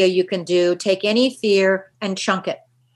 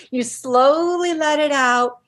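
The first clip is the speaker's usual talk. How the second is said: high, drawn-out "slowly"; 5 semitones higher than usual. Shown as a demonstration. Coach demonstrating the one-two breath exhale, slow and drawn out. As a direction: stretch "slowly" like a long exhale. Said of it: "the key is you slowly".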